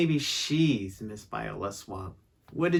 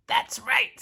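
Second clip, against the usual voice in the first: high, witchy scratchy voice; scratchy stern substitute; 10 semitones higher, 4 dB louder than usual. A narrator's reading. Substitute confronting the class, scratchy and stern. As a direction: confirm it in a raised, scratchy voice.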